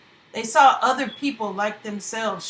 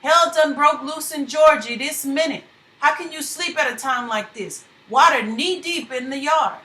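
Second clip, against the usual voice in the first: raised, urgent voice of a frightened man; urgent wake-up call; 5 semitones higher, 6 dB louder than usual. Acted. Man waking his friend in a flood, urgent and raised. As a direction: rouse him, the storm is here. Wakes friend during hurricane.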